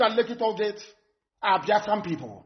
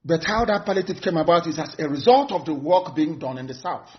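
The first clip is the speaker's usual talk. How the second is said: usual speaking voice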